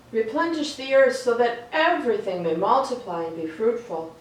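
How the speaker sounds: ordinary delivery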